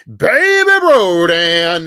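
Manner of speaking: loud, slow, drawn-out furious yell; furious shout. Real, not acted. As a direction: bellow it slowly as a threat to the rival. Angry rant at a rival streamer.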